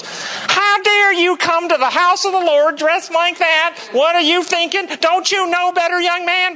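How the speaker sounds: put-on high, slow, scandalized old lady; scolding church matriarch. Matriarch scolding a visitor in shorts, high outraged old-lady voice. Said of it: "matriarch of the church ... goes".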